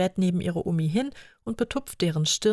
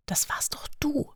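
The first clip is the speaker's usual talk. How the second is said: giggling whisper; 9 semitones higher, 4 dB quieter than usual. Acted. Whispered reply while sneaking.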